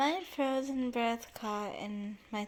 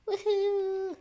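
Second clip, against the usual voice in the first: excited cheer; 9 semitones higher, 4 dB louder than usual. Real, not acted. Cheering at chapter title.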